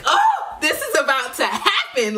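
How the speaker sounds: high-pitched excited burst; getting excited. Retold or imitated. Singer realizing her show is about to happen, excited. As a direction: let the excitement leap up into a high voice. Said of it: "I'm getting excited".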